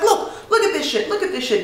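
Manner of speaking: high-pitched indignant retelling of a livid man; livid mock outburst. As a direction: imitate an outraged coworker, pitch jumping up. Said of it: "he is getting livid".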